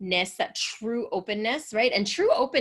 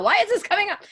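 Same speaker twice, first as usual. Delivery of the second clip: incredulous outburst; 12 semitones higher, 6 dB louder, faster than usual. Retold or imitated. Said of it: "and I'm like".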